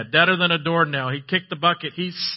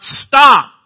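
a much higher, louder shout of command; commanding yell; 9 semitones higher, 15 dB louder than usual. Retold or imitated. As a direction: order the storm to be still. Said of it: "he yells out".

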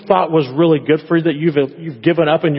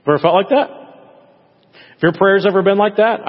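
ordinary talk, nothing marked

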